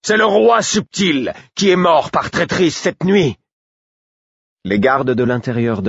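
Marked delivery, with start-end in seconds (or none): 0.0-3.4 furious shout. A narrator's reading. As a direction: spit out the accusation. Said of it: "répondis-je furieux".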